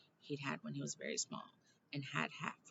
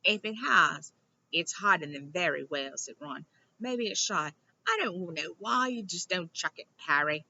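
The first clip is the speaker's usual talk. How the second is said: mocking Ron; 2 semitones higher, 12 dB louder than usual. Ron scoffing at the diary.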